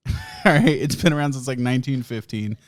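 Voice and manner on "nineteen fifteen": ordinary talk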